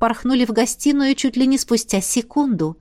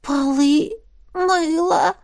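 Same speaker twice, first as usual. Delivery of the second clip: high, hoarse squeaky question; 6 semitones higher, much slower than usual. A narrator's reading. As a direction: rasp it out in disbelief. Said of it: "сиплым голосом вопросила выдра".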